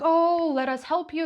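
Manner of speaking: high, imitated eager offer; mock helpfulness. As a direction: put on the guards' over-keen offer of help. Guards feign eagerness to help.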